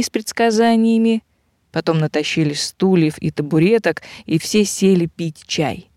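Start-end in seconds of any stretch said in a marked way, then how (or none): none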